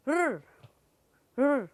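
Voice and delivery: sustained soft "ਰ"; R sound. Shown as a demonstration. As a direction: growl "ਰ" as rrr. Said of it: "sound is ਰ".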